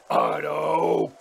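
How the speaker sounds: loud, impassioned outcry; passionate cry. A narrator's reading. Father cries out at his son, passionately. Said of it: "cried the other with passion".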